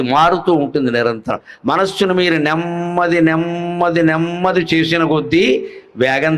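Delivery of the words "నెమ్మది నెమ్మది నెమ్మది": నెమ్మది said with a long lingering hum on the mm; drawn-out NEMMADI. Shown as a demonstration. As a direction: stretch the middle of నెమ్మది each time. Draws out "slower" to show slowing. (నెమ్మది అనే మాటను సాగదీస్తూ)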